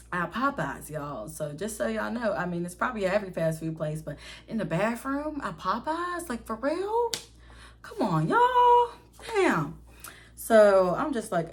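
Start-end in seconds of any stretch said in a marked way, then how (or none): 4.0-9.8 incredulous. Real, not acted. Storyteller to viewers about the fast food bathroom, incredulous. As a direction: pitch climbing in disbelief.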